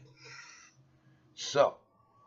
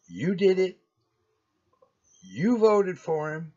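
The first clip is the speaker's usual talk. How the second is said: accusing; 7 semitones higher, 6 dB louder than usual. Real, not acted. Blaming voters in a rant.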